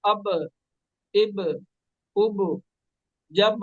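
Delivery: अब, इब, उब, जब with the lips touching and springing apart on each final ब; qalqala demo. Shown as a demonstration. Teacher shows student the bounce, crisply. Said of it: "इस तरह पढ़ना है".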